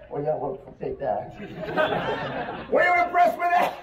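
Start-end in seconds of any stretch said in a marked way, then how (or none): 2.7-3.7 excited. Acted. Showman asks crowd.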